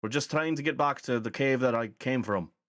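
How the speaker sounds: placating call into the camp; old man calling out. Acted. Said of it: "can't do a goblin voice with a Scottish accent".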